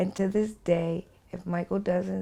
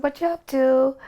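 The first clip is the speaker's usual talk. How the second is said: high, louder lilting question; Michael's sing-song; 8 semitones higher, 6 dB louder than usual. Retold or imitated. Michael chatting on the phone, high and sing-song. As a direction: make the question a little tune. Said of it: "has a way of sing-songing".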